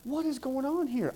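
a newcomer thinking in a strange church; bewildered inner thought. Retold or imitated. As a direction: wonder to yourself, lost and confused. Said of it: "And I'm thinking".

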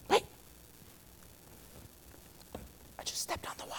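hushed, amazed whisper; awed whisper. Retold or imitated. Peter realizing he stands on water, awed whisper. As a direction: breathe it out in disbelief.

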